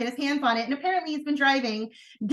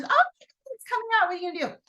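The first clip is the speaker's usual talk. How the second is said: high-pitched teasing hype; mock excited alert; 11 semitones higher, much faster than usual. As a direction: tease the big news in a high voice. Acts out excited text to customers.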